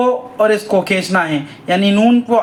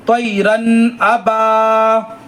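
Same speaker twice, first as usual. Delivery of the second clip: very slow, elongated reading; slow recitation; much slower than usual. Shown as a demonstration. Teacher demonstrating to pupils, slow chant. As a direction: draw every syllable out for the class. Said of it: "teacher models recitation".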